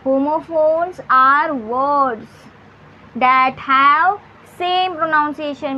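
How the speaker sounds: very slow, word by word, as if for students to copy; slow dictation. Shown as a demonstration. Teacher dictating the definition to her students, very slow. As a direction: spell out the definition slowly, one word at a time.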